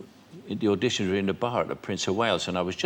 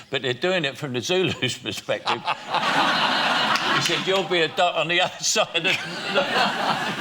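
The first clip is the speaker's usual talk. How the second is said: breaking into laughter while telling a joke; laughing; 8 semitones higher, 3 dB louder than usual. Real, not acted. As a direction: laugh your way through the punchline. Cracking up telling his joke.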